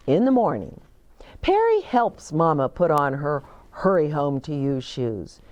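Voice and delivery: usual speaking voice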